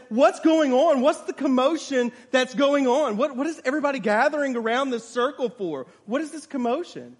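urgent questioning. Acted. Man asking the old lady about the commotion, urgent questioning. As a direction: demand answers, high and loud at first. Onlooker demands to know the commotion.

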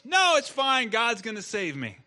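loud refusal. Acted. Flood man refuses, louder.

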